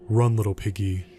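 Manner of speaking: a chilling final threat; eerie taunt. A narrator's reading. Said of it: "the man's eerie taunt".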